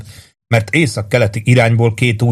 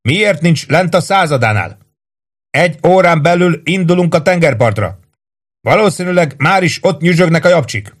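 high-pitched angry demand; narrated officer's shout; 8 semitones higher than usual. A narrator's reading. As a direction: yell up at a subordinate. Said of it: "ordította".

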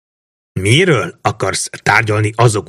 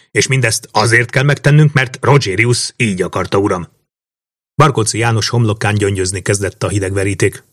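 ordinary delivery, nothing marked

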